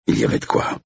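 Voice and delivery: ordinary delivery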